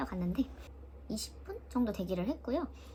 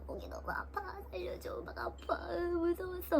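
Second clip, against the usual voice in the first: much softer, mostly voiceless plea; weak whisper; 9 semitones higher, 6 dB quieter than usual. Retold or imitated. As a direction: rasp it out barely audible. Wanting to speak with sore throat.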